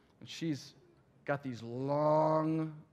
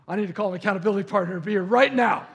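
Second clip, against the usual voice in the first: mock panic; 6 semitones higher, 13 dB louder, faster than usual. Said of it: "And I'm like".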